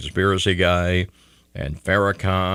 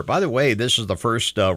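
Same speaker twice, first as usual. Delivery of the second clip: normal talking voice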